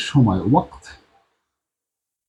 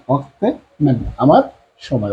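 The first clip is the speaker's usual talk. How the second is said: ordinary delivery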